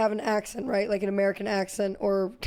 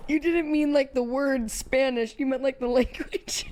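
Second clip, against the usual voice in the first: cracking up; 2 semitones higher than usual. Real, not acted. Cracking up at the misunderstanding.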